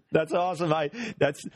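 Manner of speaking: genuinely amused, laughing through it; real laughter. Real, not acted. Host laughing at the guest's dog-walk story.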